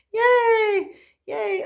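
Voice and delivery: high, loud little cheer; excited cheer. Real, not acted. Celebrating power coming.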